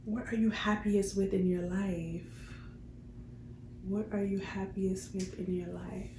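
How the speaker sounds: normal talking voice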